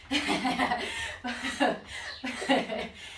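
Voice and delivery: long winded chuckle in bursts; exercising and laughing in puffs; breathy laugh. Real, not acted. Laughs while exercising alone.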